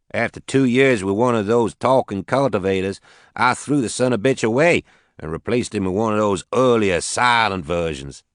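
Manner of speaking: lightly voiced Southern farmer; southern accent. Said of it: "He had a heavy southern accent".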